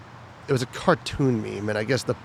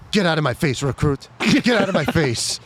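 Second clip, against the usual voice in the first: higher mock drill instructor bark; retold bark; 5 semitones higher than usual. Drill instructor dismissing a recruit at graduation, mock bark. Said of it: "she just said".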